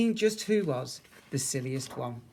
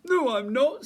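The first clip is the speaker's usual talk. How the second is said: loud, high bear denial; indignant bear; 11 semitones higher, 9 dB louder than usual. A narrator's reading. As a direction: deny it with big bluster. Bear denies being silly.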